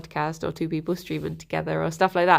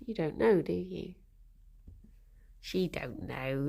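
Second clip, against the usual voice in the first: soft pet talk; 3 semitones lower, 9 dB quieter than usual. Real, not acted. Baby talk to the rabbit.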